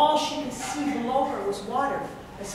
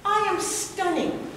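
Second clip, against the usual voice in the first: a hint of a preening bear, higher; vain bear; 8 semitones higher than usual. Bear bragging to Fox about his tail. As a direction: show off your beauty. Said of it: "And he said".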